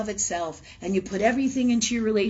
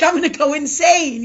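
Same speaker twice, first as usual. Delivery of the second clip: higher, louder laughing outburst; laughing exasperation; 7 semitones higher, 8 dB louder than usual. Retold or imitated. Woman laughing that homemaking drives her insane. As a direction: laugh it out, fed up with housework. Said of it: "I'm like".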